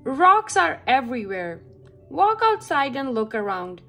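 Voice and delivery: ordinary delivery, nothing marked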